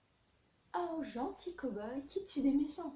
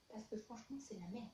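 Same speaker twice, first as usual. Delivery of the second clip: dropped to a mutter; muttered aside; 3 semitones lower, 10 dB quieter than usual. Real, not acted. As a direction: say it under your breath. Quiet blunt verdict.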